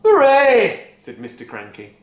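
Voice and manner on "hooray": cheer. A narrator's reading. Father cheers Grandma's vanishing.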